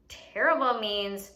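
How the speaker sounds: usual speaking voice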